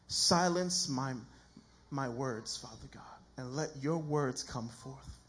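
slow, hushed prayer with long pauses. Real, not acted. Pastor praying before the sermon, slow and reverent.

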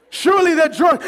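ordinary delivery

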